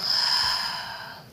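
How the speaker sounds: long sighing breath out; audible exhale. Shown as a demonstration. Guide demonstrating the exhale for listeners, audible sighing exhale. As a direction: let the breath go in one long sigh. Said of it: "and exhale".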